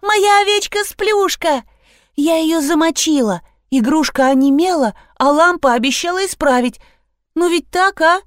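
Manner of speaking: put-on high, excited little-girl voice; squealing child. A narrator's reading. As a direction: chatter like a delighted child. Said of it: "завизжала Лиза". (высокий восторженный детский голос)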